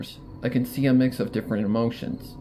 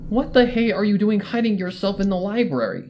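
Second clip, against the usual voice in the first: higher, sharp accusing question; 8 semitones higher, 3 dB louder than usual. Acted. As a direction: demand an explanation. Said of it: "She asks accusingly".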